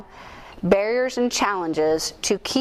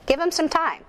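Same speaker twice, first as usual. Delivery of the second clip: much higher, pleading demand; exasperated plea; 9 semitones higher than usual. Retold or imitated. Mom's inner plea to coach at game, higher exasperated demand. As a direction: push the coach to give him a chance.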